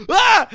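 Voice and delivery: high startled shriek; startled scream. Retold or imitated. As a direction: shriek in sudden fright. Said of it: "she heard me scream that".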